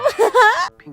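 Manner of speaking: high, loud giggle; giddy laugh. Acted. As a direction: laugh helplessly at a silly joke. Laughing at Brain's plan.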